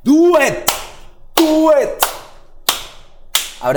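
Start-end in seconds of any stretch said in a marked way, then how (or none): 0.0-1.9 high, loud cheering push; excited urging. Real, not acted. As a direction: egg her on with a big yell. Urging her to add a show.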